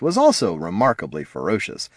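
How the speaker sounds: plain reading voice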